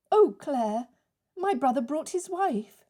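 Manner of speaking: a nervous young sister breaking bad news; Dora's voice. Dora breaks news to Claire.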